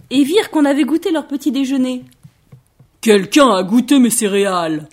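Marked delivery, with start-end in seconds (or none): 3.0-4.9 loud, booming bear; Papa Bear's big voice. A narrator's reading. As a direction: thunder it out as an indignant papa bear. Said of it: "dit papa ours d'une très grosse voix". (très grosse voix de papa ours, forte)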